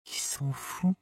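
high, hushed disbelief; alarmed remark. A narrator's reading. Companion to narrator about the armed villagers, alarmed. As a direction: say it high, as a startled aside.

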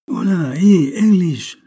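loud, high hail to a shipmate; shouted hail, high and loud; calling out. A narrator's reading. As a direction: holler across the deck, pitch jumping up. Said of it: "lui cria Marchais".

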